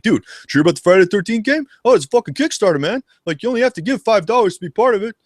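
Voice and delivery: high, fast Canadian hoser voice; excited high-pitched buddy pitch; Doug McKenzie. Pretend. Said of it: "I don't know why I sound like Doug McKenzie".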